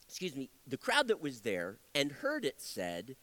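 usual speaking voice

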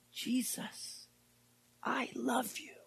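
soft unvoiced whisper of love; whispered confession. Preacher voicing Peter whispering his love to Jesus. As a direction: breathe it tenderly.

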